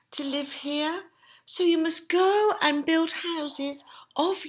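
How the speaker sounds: high, slow, loud mother pig voice; Mummy Pig. Acted. Mother pig warning her piglets, high slow voice. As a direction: warn her piglets in a high sing-song. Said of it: "Mummy Pig said".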